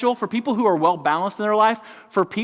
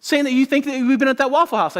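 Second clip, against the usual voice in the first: exasperated wife; 8 semitones higher than usual. Retold or imitated. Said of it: "She's like".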